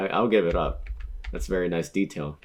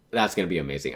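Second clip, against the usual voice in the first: ordinary talk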